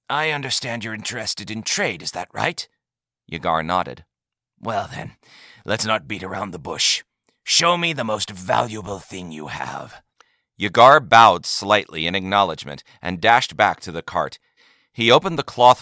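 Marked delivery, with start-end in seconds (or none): 0.1-10.0 lightly voiced old man. A narrator's reading. Old leader gently teasing the visitor, lightly aged voice.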